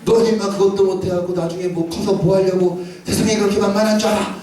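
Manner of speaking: acted-out angry telling-off; imitated mother's scolding. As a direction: scold like an exasperated mum. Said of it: "막 화를 내니까".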